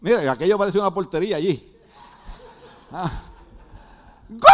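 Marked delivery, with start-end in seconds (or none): 4.3-4.5 grito de gol muy largo y agudo. Acted. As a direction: imitar al hincha gritando un gol interminable. Imita al aficionado viendo el partido. (grito de gol a todo pulmón)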